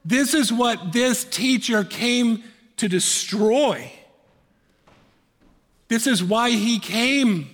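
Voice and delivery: triumphant sermon climax; full-force preaching. Acted. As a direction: preach it out with conviction.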